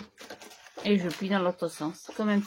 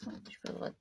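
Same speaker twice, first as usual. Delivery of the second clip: whispered aside; 14 dB quieter than usual. Real, not acted. Muttering to herself while cutting.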